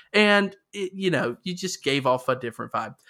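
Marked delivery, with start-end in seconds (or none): none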